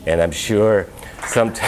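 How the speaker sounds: usual speaking voice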